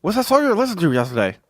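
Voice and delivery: high, fast, loud buddy impression; Fonzie impression. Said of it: "this is how he talks".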